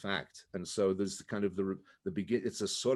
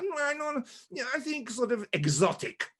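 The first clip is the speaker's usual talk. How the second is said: director's voice; 14 semitones higher than usual. Retold or imitated. Italian director voiced in story.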